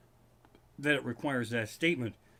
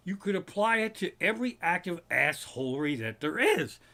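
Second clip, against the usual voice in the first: normal talking voice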